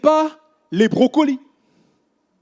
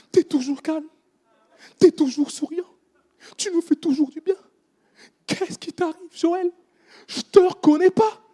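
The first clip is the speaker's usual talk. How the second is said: higher, crying voice of a disappointed boss; tearful manager; 7 semitones higher than usual. Retold or imitated. Restaurant director tearfully asks him what happened, crying. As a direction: plead through tears, hurt and puzzled. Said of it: "Elle me dit".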